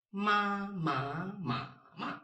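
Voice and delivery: "ma" high level, rising, dipping, then falling; four tones. Shown as a demonstration. Teacher gives ma example in all tones, level, rising, dipping, falling. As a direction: run "ma" through four pitch shapes. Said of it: "first tone, second tone".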